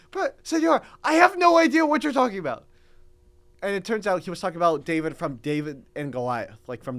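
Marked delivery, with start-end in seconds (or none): 0.1-2.7 high, loud retold exasperation; baffled protest. Student answering his professor's odd question, baffled protest. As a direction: plead total confusion, voice pitched up.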